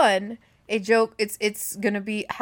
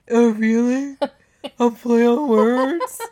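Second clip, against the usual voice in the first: mocking sister; 2 semitones higher, 10 dB louder, slower than usual. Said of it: "maybe you shouldn't have been like".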